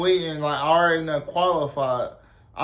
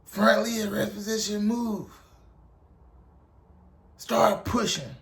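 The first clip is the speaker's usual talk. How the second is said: higher, restrained mock drill command; Drill Sergeant Kickass; 5 semitones higher than usual. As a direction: order a recruit down in a put-on sergeant voice. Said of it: "and he was like".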